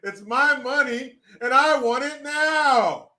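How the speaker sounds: high, slow drawn-out mock demand; hammed-up holler, pitch climbing; mock yelled demand. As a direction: playfully bellow a demand. Mock yelling demand after impression.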